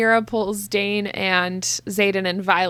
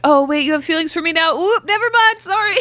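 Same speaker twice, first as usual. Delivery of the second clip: quick, high, climbing higher on the retreat; flustered backtracking; 7 semitones higher, 3 dB louder than usual. Retold or imitated. Flirt retreating once feelings appear, high hurried fluster. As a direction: mimic a skittish flirt hastily backing off.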